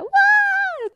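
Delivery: shrill whoop way up high; piercing high shout; scream. Retold or imitated. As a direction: let out a big excited scream. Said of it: "kommer någon som skriker så här".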